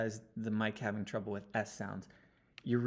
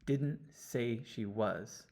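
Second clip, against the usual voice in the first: "Didn't say she was" said slowly, word by word; deliberate slow reading of the line; slow, careful phrase; much slower than usual. Shown as a demonstration. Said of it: "let's listen to the whole thing again".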